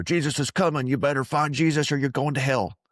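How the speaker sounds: high-pitched hellfire warning, hammed up; mock fearmongering preacher. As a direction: scare them like a caricature revivalist. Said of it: "from a place of fear".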